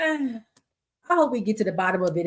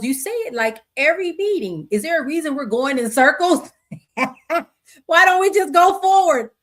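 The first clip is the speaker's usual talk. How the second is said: puzzled new guy; 6 semitones higher, 4 dB louder than usual. Acted. Reading out the new guy's lines.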